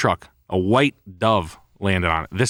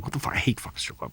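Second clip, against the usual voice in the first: a breathy whisper, much softer; muttered to himself; 5 semitones higher, 9 dB quieter than usual. Retold or imitated. Remembering leaving the strip club drunk, a hushed whisper. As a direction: whisper it like talking to yourself.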